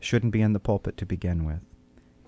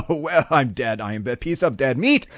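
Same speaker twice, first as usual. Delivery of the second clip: higher, hammed-up panic, half laughing; retold prophet crying out in mock dread, pitched up; mock terrified scream; 6 semitones higher, 3 dB louder than usual. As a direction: play a man sure he is about to die, in mock terror. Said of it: "Isaiah screams out".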